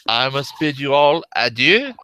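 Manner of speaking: put-on a touch of a French accent on the farewell. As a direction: bid them adieu with a light French accent. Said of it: "he says this in a very good French accent".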